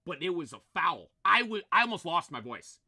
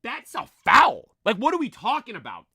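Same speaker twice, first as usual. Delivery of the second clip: retold angry yell; 4 semitones higher, 9 dB louder than usual. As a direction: yell at the ref that it was a foul. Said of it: "I'm like".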